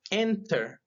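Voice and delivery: "enter" with the first syllable pitched high, then falling; stress on first syllable. Shown as a demonstration. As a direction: hit the start of "enter", let the ending drop. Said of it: "Aquí el acento está al principio". ("enter" con el acento al principio, tono alto que cae)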